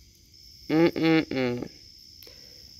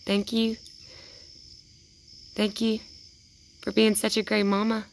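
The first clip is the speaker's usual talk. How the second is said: sing-song gratitude to a raccoon; tender thanks to animal; 5 semitones higher than usual. Real, not acted. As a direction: thank her gently like a pet. Thanking raccoon mother softly.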